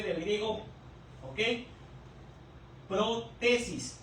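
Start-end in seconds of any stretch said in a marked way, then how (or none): 2.9-4.0 "Protesis" slowly split, pro–TESIS; pro-TESIS pronunciation. Shown as a demonstration. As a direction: sound out "Protesis" in two parts for listeners. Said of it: "la pronunciación". ("Protesis" lento, en dos partes: pro… TESIS)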